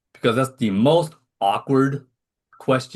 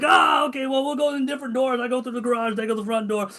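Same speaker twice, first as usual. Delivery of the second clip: higher hammed-up fluster; mock flustered; 12 semitones higher than usual. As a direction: rush it like a cornered man. Retold awkward avoidance plan.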